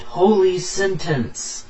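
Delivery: high, drawn-out attack call; battle cry. Acted. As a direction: cry out a special move, slow and high. Character calls out attack name.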